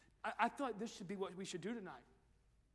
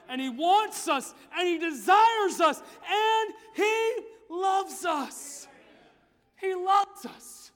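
higher, louder, slow stretched proclamation; preaching climax; 10 semitones higher, 21 dB louder, much slower than usual. Acted. Preacher crying out God's love to the teenagers. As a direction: shout out his love.

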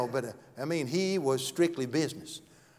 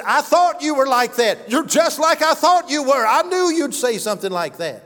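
much higher and louder, furious teacher; angry outburst; 8 semitones higher, 7 dB louder than usual. Retold or imitated. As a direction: let her blow up at the pastor. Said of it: "He said she got so mad".

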